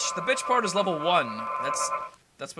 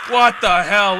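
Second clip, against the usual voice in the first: sudden loud what the hell; startled outburst; shocked; 3 semitones higher, 12 dB louder, slower than usual. Real, not acted. Sudden shock at game event.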